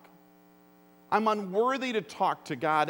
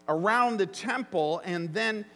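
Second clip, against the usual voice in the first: usual speaking voice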